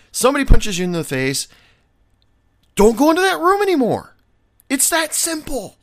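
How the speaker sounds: loud, high exasperated rant. Real, not acted. Bartender venting at complaining servers, loud exasperated rant. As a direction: hammer the point home, fed up.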